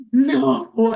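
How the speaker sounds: gasp, then a loud "no"; hammed-up horrified disbelief; mock shock. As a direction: act out a scandalized gasp and outcry. Acting out girl's shocked reaction.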